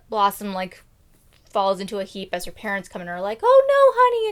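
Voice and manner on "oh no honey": high, loud cry of alarm; mock dismay. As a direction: gasp it as shocked parents. Parents find heap.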